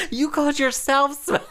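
high, laughing take on mum's retort; mocking mother. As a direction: squeak mum's comeback, amused. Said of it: "she was like".

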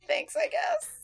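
high, grudging mock thanks; begrudging thanks. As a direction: thank him like you don't mean it. Sarcastic thanks for crude comment.

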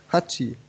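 a theatrical fake sneeze. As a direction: sneeze it out as a joke. Fake sneeze after excuse quote.

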